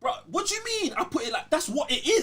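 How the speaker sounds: can't believe he has to explain it; incredulous. Real, not acted. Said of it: "That's mad, you know, you put it like that".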